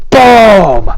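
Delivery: loud, high booming sound effect; explosive impact. A narrator's reading. Narrator landing the lightning bolt, explosive boom.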